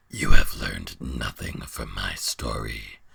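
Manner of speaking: very low, slow monster voice; deep slow beast voice. Acted. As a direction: scold like a disappointed giant. Beast rebukes.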